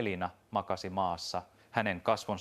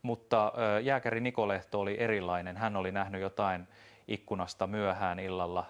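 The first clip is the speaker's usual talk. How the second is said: ordinary delivery, nothing marked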